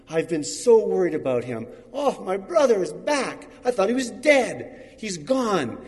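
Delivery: loud, high, emotional burst of relief; overjoyed relief. Acted. Brother overjoyed his lost sibling is back, loud and high. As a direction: cry out that your lost brother is home.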